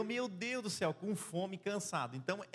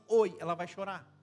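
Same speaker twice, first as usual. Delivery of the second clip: um "Oi" curto, bem mais alto; oi exagerado; 6 dB louder than usual. Pretend. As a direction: solte um "Oi" forte e seco. Esposa chora com simples oi. ("Oi" alto e brusco)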